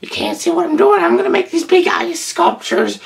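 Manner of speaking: high, light sprite voice; high playful voice. Retold or imitated. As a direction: squeak it out like a giddy sprite. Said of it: "He's like".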